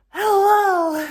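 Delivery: very high, loud, sing-song hello; overeager greeting. Retold or imitated. Traveller greeting the rental clerk, loud and high with relief. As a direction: burst out with relief at the desk. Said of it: "I probably frightened this young man".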